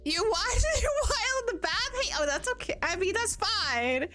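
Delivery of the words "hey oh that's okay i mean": usual speaking voice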